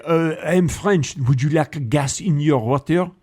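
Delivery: slow, put-on French accent; mock-French English. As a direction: play a French person speaking English. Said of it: "They say".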